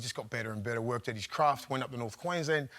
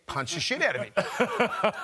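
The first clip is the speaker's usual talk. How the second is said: laughing through it; 6 semitones higher, 3 dB louder, slower than usual. Retold or imitated. Urging teammate to fight, laughing.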